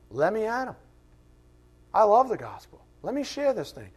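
high, keen and raring to go; eager new creature. Acted. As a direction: plead to be let loose. New creature eager to share gospel.

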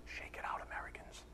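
whispered "Shake it out". Real, not acted. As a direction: whisper "Shake it out" under your breath. Whispered aside to congregation.

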